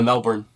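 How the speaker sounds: "Melbourne" in two syllables, as in the city; MELBOURNE pronunciation. Shown as a demonstration. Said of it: "I've always said".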